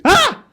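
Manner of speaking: short, high-pitched mock shriek; mock scream. Reviewer imitating a film character who suddenly screams, short high mock scream. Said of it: "suddenly he just screams, he just goes".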